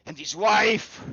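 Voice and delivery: high, loud aggrieved lord; wounded, furious Baron. Acted. Baron berating the crowd over his lost family, loud and hurt. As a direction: rage at them for mocking his grief.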